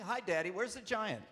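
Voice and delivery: put-on high, loud excited child questions; eager little girl. Dad mimics his daughter on the phone, high and eager. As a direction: pepper Daddy with questions like a thrilled kid.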